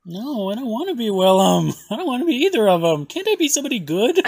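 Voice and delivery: loud laughing protest. Real, not acted. Host protests to co-host about sounding like Willem, loud and whiny.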